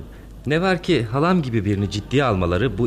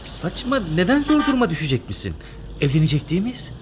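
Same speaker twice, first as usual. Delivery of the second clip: indignant protest; 7 semitones higher than usual. Acted. Fiancé protests being avoided.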